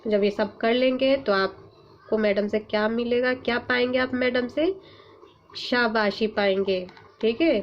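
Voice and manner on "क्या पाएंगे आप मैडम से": normal talking voice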